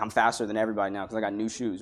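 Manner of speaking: low, fast, soft mock kid brag; tossed-off imitation of a boastful kid; kid bragging. Little kid with new shoes bragging: quick soft mock brag.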